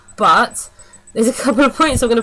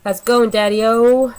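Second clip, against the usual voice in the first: lightly voiced greaser, a bit louder; cool-guy greeting; 2 semitones higher, 4 dB louder than usual. Reviewer lightly imitating the vampire teen greeting his dad. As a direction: toss it off like a wannabe Fonzie. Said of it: "he just goes".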